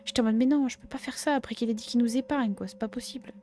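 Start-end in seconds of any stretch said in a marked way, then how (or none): none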